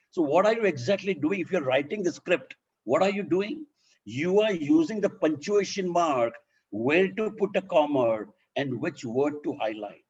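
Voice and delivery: ordinary talk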